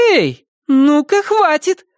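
high, sharp call to stop; high bold girl voice. A narrator's reading. Aza shouts at intruders.

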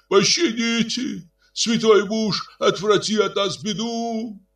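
high pleading wail; вопль толпы. A narrator's reading. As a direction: beg the holy man in a wail. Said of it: "завопила толпа". (мольба с воплем)

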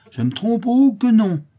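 high, loud, swooping sarcasm; bitter irony. A narrator's reading. Widow rejecting Clara's doubt, bitterly ironic. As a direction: scoff loudly at the very idea. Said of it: "avec une ironie amère".

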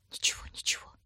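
soft, voiceless consoling whisper; hushed soothing words; whispered reassurance. A narrator's reading. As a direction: whisper comfort at a parting. Consoling at a parting.

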